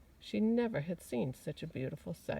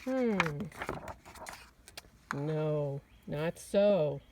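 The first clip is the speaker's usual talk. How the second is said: louder, sniffy woodchuck dismissal; sniffing woodchuck; 4 dB louder, much slower than usual. Acted. Woodchuck dismissing the rabbit, sniffing loudly. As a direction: brush it off with a sniff. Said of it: "sniffed the woodchuck".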